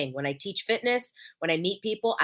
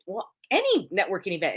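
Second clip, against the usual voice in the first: usual speaking voice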